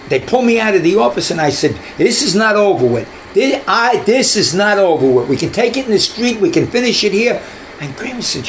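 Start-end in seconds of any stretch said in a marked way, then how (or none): 2.0-7.4 higher, heated challenge; heated mock threat; retold threat. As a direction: throw down a challenge while being dragged off. Threatening cop after being pulled away.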